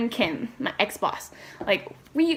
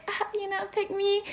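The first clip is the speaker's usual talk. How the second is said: coy shy plea; 11 semitones higher, 5 dB quieter than usual. Retold or imitated. Shy fake-modest quote.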